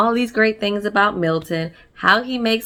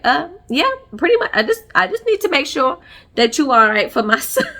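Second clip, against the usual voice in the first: higher, laughing mom imitation; mock mom voice; 6 semitones higher, faster than usual. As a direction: play the mom, amused and sure of herself.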